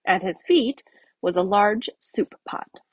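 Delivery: usual speaking voice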